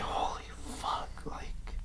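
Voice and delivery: very soft, stunned whisper; retold hushed shock; awed whisper. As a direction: whisper it in disbelief. Retold awe at getting in.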